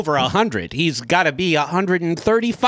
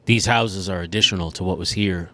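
put-on very old man voice; 6 semitones lower than usual. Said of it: "he's very old, so he talks like".

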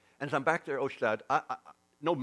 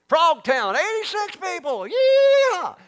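high whooping country-bumpkin holler, much louder; mock hillbilly cheer; 15 dB louder, much slower than usual. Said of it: "this is hee haw moves to Southern California".

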